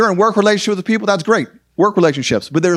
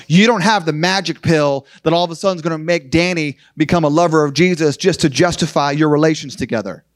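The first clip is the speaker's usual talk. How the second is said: ordinary talk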